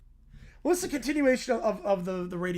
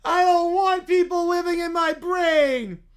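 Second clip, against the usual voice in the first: put-on crying child; 6 semitones higher, 8 dB louder than usual. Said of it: "Debbie starts crying".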